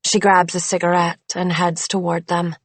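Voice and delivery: usual speaking voice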